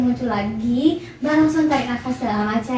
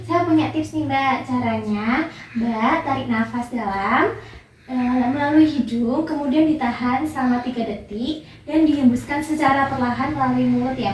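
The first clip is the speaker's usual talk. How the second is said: ordinary talk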